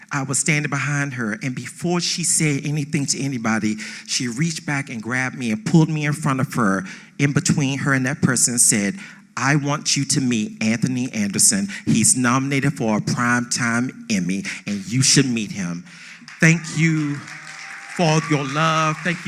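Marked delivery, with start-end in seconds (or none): none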